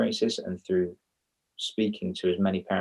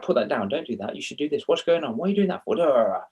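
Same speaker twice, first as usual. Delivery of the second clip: higher, rattled-off parental orders; nagging parent; 5 semitones higher, faster than usual. Retold or imitated. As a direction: reel off the nagging like a tired parent. You say the same things in the same order.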